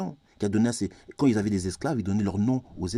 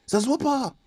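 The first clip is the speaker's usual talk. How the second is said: high emphatic repeat; 13 semitones higher than usual. Real, not acted. Ranter insisting origins don't show, high emphatic repeat. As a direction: pitch leaps far up, insistent.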